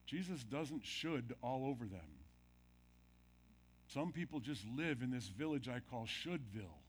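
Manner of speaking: ordinary delivery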